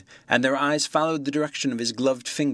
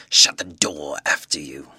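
much lower, loud menacing command; low looming threat; 10 semitones lower, 5 dB louder than usual. Acted. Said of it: "in a tremendous voice".

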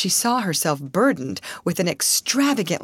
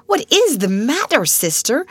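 higher, alarmed; startled cry; 8 semitones higher, 5 dB louder than usual. A narrator's reading. Miss Amelia sees her sister's angry face, startled. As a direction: blurt it out in alarm. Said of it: "she ejaculated".

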